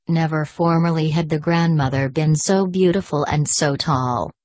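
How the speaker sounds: usual speaking voice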